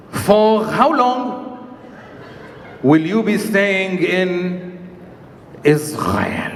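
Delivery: very slow, low, drawn-out official question; slow deep border-guard drawl; slow officer question. Retold or imitated. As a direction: drag every word out like a bored border officer.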